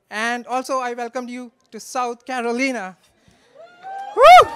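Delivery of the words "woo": loud high cheer; excited whoop. Real, not acted. Toasting brother cheers for the bride with a whoop. Cheering South Carolina welcome.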